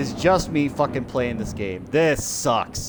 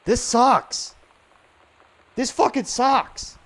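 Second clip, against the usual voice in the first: whiny complaint; 10 semitones higher, slower than usual. Real, not acted. Streamer fed up with scary game.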